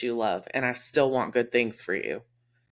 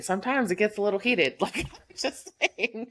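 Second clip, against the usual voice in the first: laughing; 7 semitones higher than usual. Real, not acted. Laughs at her own stubbornness.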